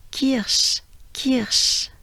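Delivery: kirsch said as kiʃ with a crisp k. Shown as a demonstration. Teacher building up the full word. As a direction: start kirsch on a clear k. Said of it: "It starts with a k".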